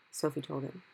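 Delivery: usual speaking voice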